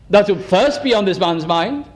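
normal talking voice

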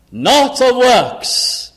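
slow, high and loud, stretched out; emphatic slow scripture declaration; proclaimed verse. Acted. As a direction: ring it out from the pulpit, slowly. Preacher repeats verse emphatically.

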